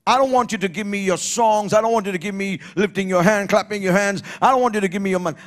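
hoog, snel opgezweept prekerstempo; preken. Acted. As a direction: preach it high and urgent, line upon line. Preaches at full force.

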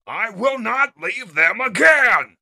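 high, loud vow building to a roar; impassioned leader's bellow; defiant shout. Acted. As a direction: shout your refusal with full force. Leader refuses to abandon his comrades.

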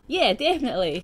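loud, high, emphatic yes; eager agreement. Acted. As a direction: agree with gusto, punching it out.

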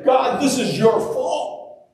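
high-pitched mock complaint to God; hammed-up aggrieved accusation; acted blaming. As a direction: protest as if it's all God's fault. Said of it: "go as far as saying".

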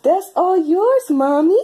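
high, slow cooing. Real, not acted. Mother offering her baby a toy in baby talk.

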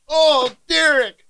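high crying shout at the sourness; pained shout. Real, not acted. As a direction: cry out in sour agony. Reacting to sour cupcake.

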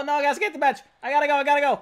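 high, loud flustered rush; panicked hurry. Real, not acted. Gamer rushing to the bench, high loud panic.

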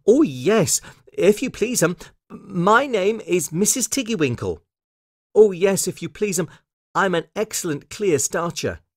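high, polite little washerwoman; eager to please, in a high lilting voice; Missus Tiggywinkle. Acted.

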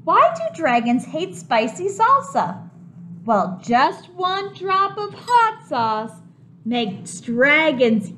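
animated picture book voice; reading to children. A narrator's reading. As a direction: make it exciting for the kids.

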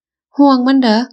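"ห่วงมันเหรอ?" flung out high and loud, falling at the end; shrill hateful shriek. Acted. Wife jealously accusing her husband, shrieking with hatred. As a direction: spit the jealous question up high. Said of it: "กรีดเสียงแหลมด้วยความชิงชัง".

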